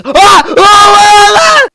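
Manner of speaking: shrill, loud excited screaming; high screams. Real, not acted. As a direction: scream your head off in delight. Screaming at Christmas reveal.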